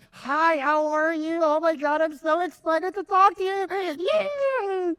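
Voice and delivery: very high, slow, gushing phone greeting; overexcited caller. Retold or imitated. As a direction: squeal with fake delight. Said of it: "So when you get on the phone".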